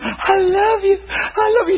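very high, hammed-up adoring cries; mock tearful gushing. Preacher imitating women worshipping Jesus, mock tearful gushing. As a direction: imitate a weepy, smitten worshipper.

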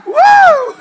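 high, loud joyful woo; loud whoop. Real, not acted. Preacher whooping to the congregation, loud and high. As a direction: let out a big whoop.

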